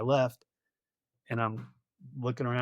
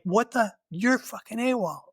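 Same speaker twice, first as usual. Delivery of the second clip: mock freak-out; 9 semitones higher than usual. B team to returning soldier, mock freaking out. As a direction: imitate them freaking out in a higher voice. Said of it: "they're freaking out".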